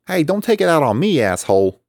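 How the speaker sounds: high, loud indignant complaint. Acted. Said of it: "Andy complained".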